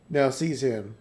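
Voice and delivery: ordinary delivery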